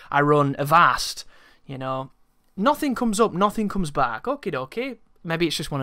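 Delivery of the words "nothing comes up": ordinary delivery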